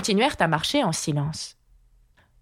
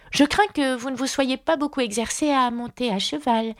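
put-on higher, careful little-girl voice; Alice, fillette prudente; 7 semitones higher than usual. A narrator's reading. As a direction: play a polite young Alice venturing a remark. (voix plus aiguë de fillette prudente)

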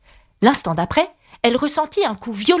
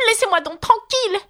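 indignant; 11 semitones higher than usual. Acted. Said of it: "riposta Alice d'un ton indigné".

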